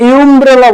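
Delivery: ইউমব্রেলা said with a yu sound at the start; ইউমব্রেলা, teacher's deliberately wrong yoo onset; wrong pronunciation. Shown as a demonstration. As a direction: say ইউমব্রেলা as yoo-mbrella to show the error. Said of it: "না, আমব্রেলা বলি".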